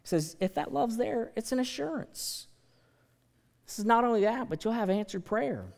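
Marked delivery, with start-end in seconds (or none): none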